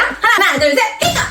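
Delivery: high-pitched excited count-in; calling out bingo. Shown as a demonstration. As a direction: count up to a gleeful high call. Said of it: "빙고를 외치게 합니다".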